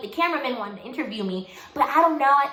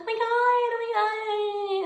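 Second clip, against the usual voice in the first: very high, drawn-out squeal; mock a girlish gush, almost singing it; higher voice; 12 semitones higher, slower than usual. Girls reacting to a sixteen-year-old, squealed high. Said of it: "I had a higher voice, higher. Like".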